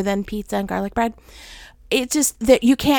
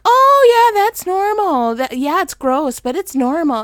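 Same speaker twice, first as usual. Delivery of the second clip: breezy nurse; 7 semitones higher, 5 dB louder than usual. Retold or imitated. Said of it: "they're like".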